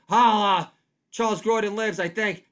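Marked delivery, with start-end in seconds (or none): 0.1-0.7 loud holla. Acted. Catchphrase shout.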